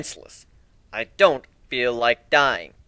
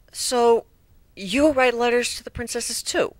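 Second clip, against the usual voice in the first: nervously; 7 semitones higher, slower than usual. A narrator's reading. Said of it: "She asked nervously".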